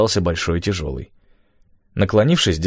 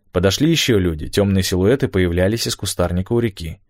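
ordinary delivery, nothing marked

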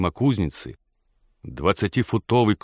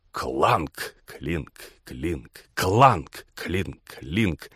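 imitated hammer blows; 3 semitones lower, much slower than usual. A narrator's reading. Narrator recalling the smithy hammers, ringing imitation. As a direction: sound out the hammer's heavy and light strokes.

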